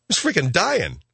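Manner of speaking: ordinary talk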